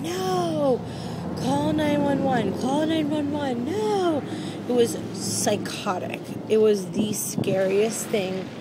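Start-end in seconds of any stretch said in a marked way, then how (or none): 0.0-4.2 high pleading mock yell; retold screaming. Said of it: "and I was screaming".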